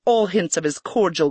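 plain reading voice

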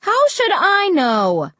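high, drawn-out exasperated holler; shouting back. A narrator's reading. Mom answering Dad from another room, slow high shout. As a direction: shout it back through the house. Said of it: "Mom shouted back".

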